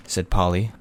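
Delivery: plain reading voice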